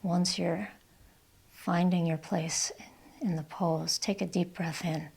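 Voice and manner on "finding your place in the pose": ordinary delivery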